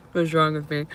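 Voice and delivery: ordinary talk, nothing marked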